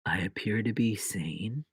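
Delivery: low, hushed whisper. Pretend. Host insisting on sanity after screaming, whisper. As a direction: whisper it as an aside.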